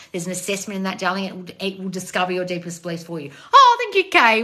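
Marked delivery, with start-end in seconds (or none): 3.5-4.4 gushing high squeal of thanks; delighted, much higher thanks. Real, not acted. Thanking for a gift.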